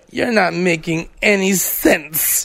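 very slow and dazed; imitating her sluggish tone; slow drunk reply. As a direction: drag it out, confused.

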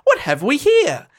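high, theatrical questioning; Trinculo the jester. A narrator's reading. Jester puzzling over a monster under a cloak, high theatrical wonder. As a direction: declaim it high, slowing on the first question. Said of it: "Trinculo sees Caliban ... and says".

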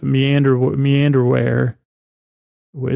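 ordinary delivery

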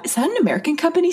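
high, quick joke question; mock question. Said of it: "just gonna make a bad".

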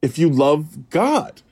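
high, preachy proclamation; emphatic reassurance. Retold or imitated. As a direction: proclaim it like an absolute promise. Acts out counselor's emphatic reassurance.

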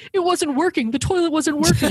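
high-pitched retelling in another's voice; high, insistent quoted voice; high mimicked partygoers. Partygoers retelling the story mockingly.

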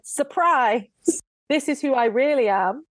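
spring the surprise, lightly acted; mock big reveal. Prince unmasking himself to his sweetheart, mock big reveal.

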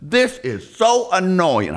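drawn-out complaint. Retold or imitated. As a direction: stretch out the complaint. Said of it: "So he's driving through like".

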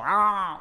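loud, high mock [name] laugh at the punchline. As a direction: belt out a cheeky London "Ah". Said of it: "and then she'll go".

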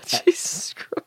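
exasperated whisper. Real, not acted. Sighing at the story.

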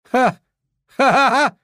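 high, loud gleeful ha-ha; triumphant laughing cry. A narrator's reading. Narrator cheers that the tourists are gone, triumphant. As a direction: crow with delight. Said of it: "rief ich".

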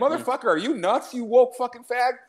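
mock outburst. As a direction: fire it back at the pushy uncle. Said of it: "I'd be like".

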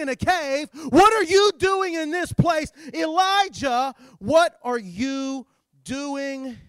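high, loud, impassioned appeal; full-force preaching. Acted. Preacher voicing God's call to Elijah, full-force preaching. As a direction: belt out the questions, slowing down at the last.

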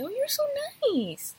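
a light gushing imitation; gushing compliment. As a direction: imitate people cooing over how nice she is. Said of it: "they always say".

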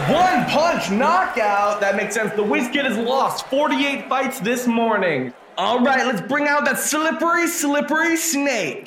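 booming ring announcer. Acted. Ring announcer calls the result.

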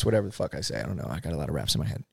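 ordinary delivery

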